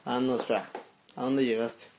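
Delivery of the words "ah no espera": ordinary delivery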